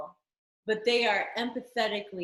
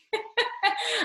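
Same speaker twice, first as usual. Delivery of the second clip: bursting high laugh; high bright laugh; 5 dB louder than usual. Real, not acted. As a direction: laugh out, moved and delighted. Laughs at a touching memory.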